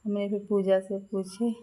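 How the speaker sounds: ordinary talk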